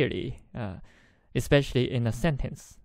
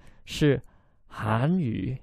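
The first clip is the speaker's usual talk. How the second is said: "韩" stretched long and slow; imitating a woman insisting on a long "韩"; long, strong stress; slower than usual. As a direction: draw out "韩" so it is heard clearly. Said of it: "so very long, very strong to make the man".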